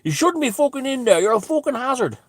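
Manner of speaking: a sneering high-pitched impression of the man; mock high scold. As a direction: mimic the busybody's shrill nagging. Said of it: "he goes".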